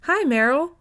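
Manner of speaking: bright high greeting, pitched well up; cheerful hello, voice jumping high. A narrator's reading. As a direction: greet her with a big cheerful lift. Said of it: "Vash said cheerfully".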